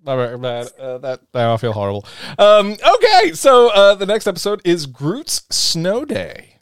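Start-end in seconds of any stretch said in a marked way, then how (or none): 2.4-6.5 excited announcement. Acted. Announcing next episode, high pitch.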